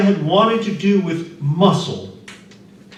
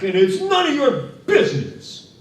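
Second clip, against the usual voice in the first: put-on bellowing drunk; 13 semitones higher, 3 dB louder than usual. A narrator's reading. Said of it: "the laborer bellowed back".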